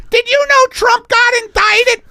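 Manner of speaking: very high, loud falsetto begging; hammed-up panic; desperate pleading. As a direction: shriek for mercy from the lights. [name] blurts a distraction.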